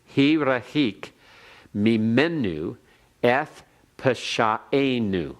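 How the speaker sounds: slow Hebrew, each word set apart; careful teacher's Hebrew recitation; Hebrew read aloud. Shown as a demonstration. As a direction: model the line for the class. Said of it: "everyone repeat it after me".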